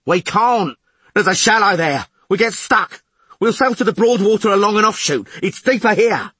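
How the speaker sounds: high, loud, urgent argument; shouted retort. A narrator's reading. Skipper arguing course with lookout, loud urgent shouting. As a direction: shout down the objection.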